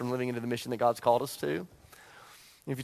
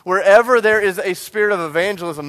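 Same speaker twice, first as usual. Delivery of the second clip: louder preacher's declaration; preaching build; 3 semitones higher, 8 dB louder than usual. Acted. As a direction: proclaim it to the church.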